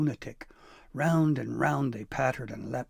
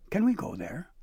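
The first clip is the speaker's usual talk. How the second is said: eager question; 7 semitones higher than usual. Acted. Eager boy asks.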